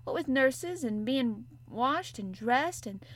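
a touch of a broad Yorkshire maid lecturing; Yorkshire maid, unhurried; lightly voiced Yorkshire maid. Martha's Yorkshire voice.

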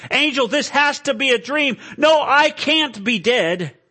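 high, loud, anguished protest; desperate pleading. Acted. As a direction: plead frantically against the verdict. Acted sinner pleads with the angel.

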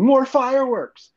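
high, drawn-out egging-on cry; mock cheering. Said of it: "clapping really loud and going".

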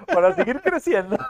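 still laughing. Real, not acted. Sigue riendo del chiste.

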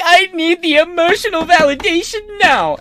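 very high, sustained whiny parody; hammed-up demanding woman in falsetto; mock a needy woman, high-pitched; mocking women's voice. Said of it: "women gotta be like".

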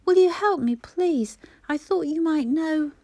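high, loud, breathless pleading; desperate mother begging for help; frantic plea. A narrator's reading. Mother asking the neighbour to search, frantic plea.

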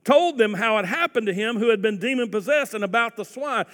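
fervent pulpit reading; forceful scripture reading. Acted. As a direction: build the story with energy. Scripture read with rising force.

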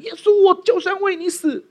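high, loud mock vow of loyalty; Peter's boast. Disciple vowing loyalty to Jesus at the Last Supper, high mock bravado. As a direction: swear it with blustering certainty. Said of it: "上一个这样做的人在最后晚餐".

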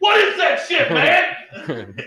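very high, loud hammed-up yell, cracking into laughter; mock outraged yell. A passerby at Rockefeller Plaza yells at the statue, mock outrage. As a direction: shout it like a clueless passerby, laughing. Passerby's shocked reaction imitated.

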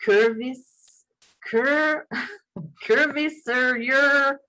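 "Curviserior" sounded out haltingly, drawn out; stumbling attempt. Real, not acted. Host trying to say a hard word, stumbling. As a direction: break off at "Cur-", laugh, then stretch "Curviserior". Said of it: "I'm gonna try to say this".

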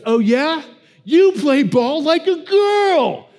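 put-on high, loud, drawn-out taunt like a kid; cocky freckled kid. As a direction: jeer it like a bratty little boy. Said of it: "the little freckled kid, he goes".

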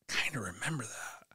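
very soft, half-whispered; hushed recollection. Retold or imitated. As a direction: say it to yourself, barely aloud. Retells dim recognition of memory.

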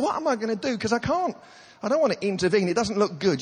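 high-pitched anxious thought; flustered dithering. Retold or imitated. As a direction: fret aloud, acting out inner panic. His panicked inner debate, retold.

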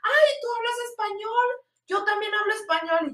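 high-pitched mock delight; delighted rose seller. Seller learns the tourist speaks Spanish, high mock delight. Imitates seller finding shared language.

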